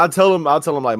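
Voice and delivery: ordinary delivery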